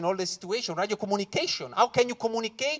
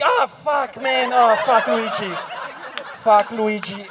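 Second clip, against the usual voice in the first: dismayed shouting; 7 dB louder, slower than usual. Retold or imitated. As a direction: yell at your fallen friend. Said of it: "I'm like".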